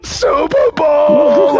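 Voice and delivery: high, loud, drawn-out shout; freaking out. Retold or imitated. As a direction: bellow it like a fan losing it. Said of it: "freaking the fuck out".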